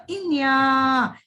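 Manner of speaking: इया held long and clear; slow clear model. Shown as a demonstration. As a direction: stretch इया for the kids to copy. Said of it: "सही तरीके से उच्चारण कीजिएगा".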